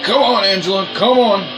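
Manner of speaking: ordinary delivery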